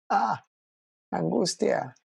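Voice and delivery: a sudden high, hammed-up cry of anguish; mock anguish. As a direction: cry out in pretend panic. Buen trabajador que no puede trabajar. (grito de angustia fingido y exagerado)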